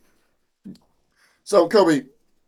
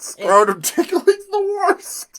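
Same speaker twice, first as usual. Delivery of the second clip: slow, very high wail; high squeal; much slower than usual. Acted. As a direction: stretch it into a high whine. Elf groans about chore.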